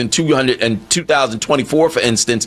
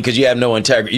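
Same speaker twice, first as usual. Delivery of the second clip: ordinary talk, nothing marked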